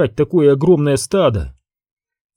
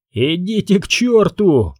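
mild narrated cry, raised in pitch; higher-pitched, restrained outcry; understated shout; 5 semitones higher than usual. A narrator's reading. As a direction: cry it out without full volume. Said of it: "крикнул Карсон".

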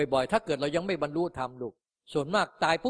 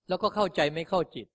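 normal talking voice

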